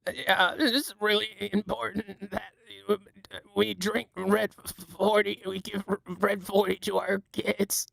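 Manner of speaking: high, soft, slow RFK Junior voice; RFK Junior impression. Streamer doing RFK Junior for chat, high and soft. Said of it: "R F K Junior".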